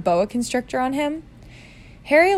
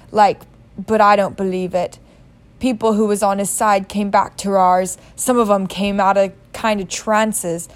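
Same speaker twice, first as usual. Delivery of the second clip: big, louder rustic giant voice, earnest; Hagrid voice; 3 dB louder than usual. Acted. Hagrid explains Voldemort's vanishing to Harry, loud rustic giant. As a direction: tell it like a gruff big-hearted giant.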